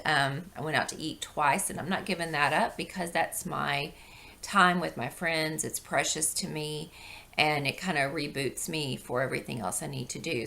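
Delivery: ordinary talk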